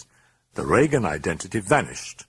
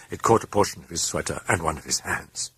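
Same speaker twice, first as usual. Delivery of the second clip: ordinary delivery, nothing marked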